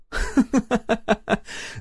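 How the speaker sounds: high-pitched giggling peal; ringing laugh. A narrator's reading. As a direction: burst out laughing high and clear. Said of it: "Звонко расхохоталась супруга". (звонкий хохот)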